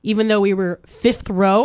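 usual speaking voice

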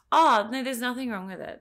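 high, loud, dismissive mock doctor voice. Patient mimicking ER staff dismissing her, mocking and high. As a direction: brush it off like a bored doctor.

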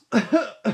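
loud, high put-on cough; awkward fake cough. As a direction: cough pointedly to cover the embarrassment. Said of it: "there's, like, one of those coughs".

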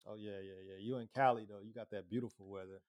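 ordinary talk

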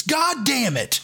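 retold curse, voice jumps high; mock angry curse. Buddy slams mop, curses.

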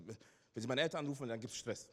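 ordinary delivery

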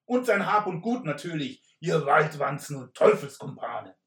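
knight shouting. Acted. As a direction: thunder the command like a stage knight. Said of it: "rief der Ritter lauter".

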